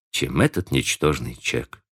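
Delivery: usual speaking voice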